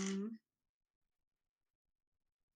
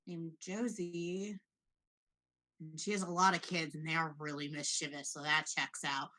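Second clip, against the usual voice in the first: Bill; 2 semitones lower than usual. Acted. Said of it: "Bill's gonna be, like, squinting at it".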